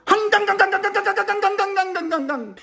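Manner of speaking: long, very high ringing nonsense run; machine-gun string of pretend words, high-pitched; mock foreign gibberish. Inmate to his translator, long high mock gibberish. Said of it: "And the dude goes".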